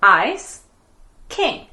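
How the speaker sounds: "Ice" and "King" as two separate beats; split into syllables. Shown as a demonstration. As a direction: pause between "Ice" and "King". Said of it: "Two syllables".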